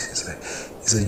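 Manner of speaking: plain reading voice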